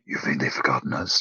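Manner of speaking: low, quiet monster voice; Venom. Retold or imitated. Friend voicing Venom left sitting in the corner, low creature voice. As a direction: murmur it deep, like a forlorn creature. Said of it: "Venom's just sitting in the corner going".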